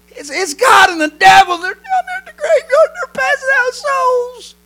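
very high, stammering panicked squeal; hysterical boy. Acted. Grandson to grandpa about graveyard souls, hysterical high squeal. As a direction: climb into a drawn-out frightened wail.

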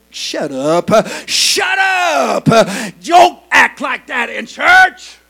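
loud, scolding shout, slowed on the shut ups; crowd hushing angrily. Acted. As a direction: rebuke him like an outraged churchgoer. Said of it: "everybody around him said".

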